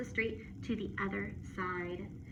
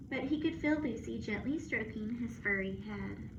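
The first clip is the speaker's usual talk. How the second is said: usual speaking voice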